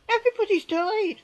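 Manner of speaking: real anguish bursting out; anguished outcry. Real, not acted. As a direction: cry it out in despair. Grief, repeating the loss louder.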